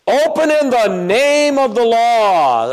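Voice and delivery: high, slow, commanding call; voice of authority. Retold or imitated. An officer demanding entry, slow and commanding. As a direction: bark it like an officer at the door.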